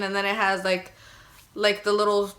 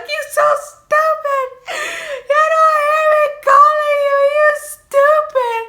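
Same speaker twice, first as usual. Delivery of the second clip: sobbing yell; 10 dB louder than usual. Retold or imitated. Wife screaming through tears at sleeping husband, sobbing shout. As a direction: scream it at him through sobs.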